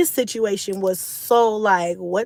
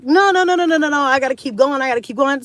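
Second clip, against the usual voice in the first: insistent retold protest; 5 semitones higher, 6 dB louder, faster than usual. Retelling insisting to keep going.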